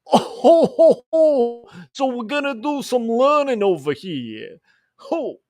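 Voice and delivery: loud, high, ho-ho teacher character; goofy teacher voice. Pretend. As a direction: boom it out like a jolly goofy teacher. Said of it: "what I sound like in front of my students".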